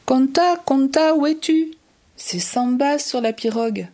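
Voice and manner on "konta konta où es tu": loud, much higher call across the water. Acted. Samba searching for Konta from the canoe, loud high call. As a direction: call her name from far away.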